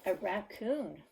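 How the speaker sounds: ordinary delivery, nothing marked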